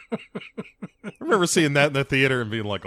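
usual speaking voice

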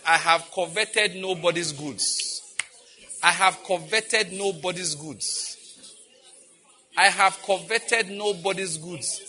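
slow, measured, words spaced out; slow repeated declaration. Acted. Pastor quoting Paul to the church, slow and deliberate. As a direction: lay each word down deliberately.

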